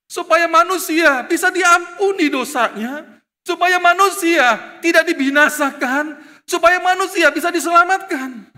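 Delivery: high, loud, insistent sermon delivery; full-force preaching. Real, not acted. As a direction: drive each point home to the congregation.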